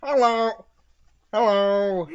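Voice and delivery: high, loud drawn-out greeting; silly monster voice. Acted. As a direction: try out a silly card-creature voice, high and loud. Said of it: "what would be the voice of this?".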